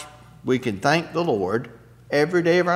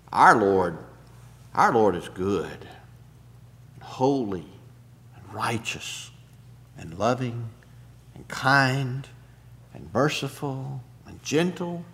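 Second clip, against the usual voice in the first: tender reverence; 3 semitones lower, 5 dB quieter than usual. Real, not acted. Tender list of God's goodness.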